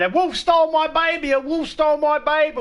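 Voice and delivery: Chamberlain lady wailing. Retold or imitated. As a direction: shriek it like a frantic mother mocked. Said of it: "the fucking Chamberlain lady had a went".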